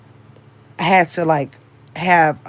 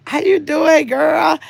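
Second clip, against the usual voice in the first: cheerful greeting; 9 semitones higher, 4 dB louder, slower than usual. Real, not acted. Greeting a viewer joining.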